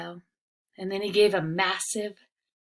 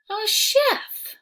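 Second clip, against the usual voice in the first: put-on high, hesitant child voice; a small boy unsure of himself; 14 semitones higher, 6 dB louder than usual. Riley telling his cousin he is unsure, high child voice.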